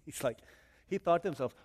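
usual speaking voice

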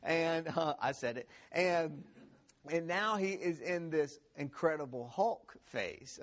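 ordinary delivery

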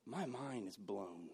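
soft, slow awed hush; hushed amazement. Retold or imitated. Wife amazed he stayed calm, hushed and slow. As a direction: let it land slowly and quietly. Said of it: "She goes".

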